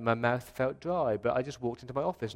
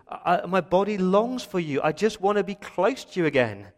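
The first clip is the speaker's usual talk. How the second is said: raised, louder cry of longing; David's longing; 6 semitones higher, 6 dB louder than usual. Acted. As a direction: call out yearningly to God. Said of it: "That's how David feels".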